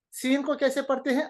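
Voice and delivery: usual speaking voice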